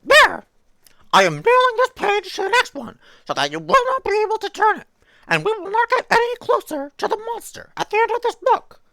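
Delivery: very high, loud, proud puppet; high Muppet shout of triumph; triumphant Grover. Acted. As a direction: announce the fix in a high squeal. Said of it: "tried to do the Grover voice".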